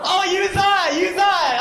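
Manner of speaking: giddy delight at a fan; excited. Real, not acted. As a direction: point her out with glee. Excited at a laughing audience member.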